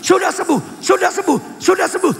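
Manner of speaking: high, loud repeated proclamation; insistent declaration. Retold or imitated. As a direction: act out someone repeating faith relentlessly. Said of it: "Sepanjang jalan pulang dia katakan". (seruan iman berulang, tinggi dan lantang)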